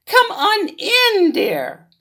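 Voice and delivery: put-on high, slow old grandma welcome; grandma sings out her welcome; inviting grandma. Grandma invites the wolf in, high and slow.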